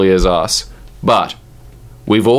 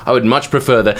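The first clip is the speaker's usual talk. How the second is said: ordinary talk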